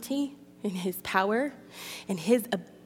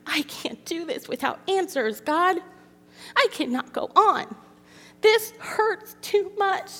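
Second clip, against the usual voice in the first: anguished plea; 10 semitones higher, 3 dB louder than usual. Retold or imitated. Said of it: "that time where I was like".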